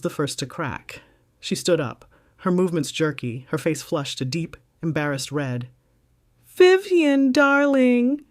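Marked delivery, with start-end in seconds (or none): 6.5-8.2 much higher and louder, flustered friend stammering; a flustered friend blurting out her excuse. Acted. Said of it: "she stammered".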